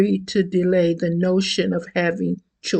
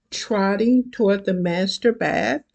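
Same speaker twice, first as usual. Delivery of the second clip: ordinary delivery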